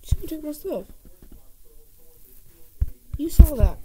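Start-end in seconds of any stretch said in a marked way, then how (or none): 3.2-3.8 loud, high triumphant call; loud high boast; excited brag. Real, not acted. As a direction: crow about it.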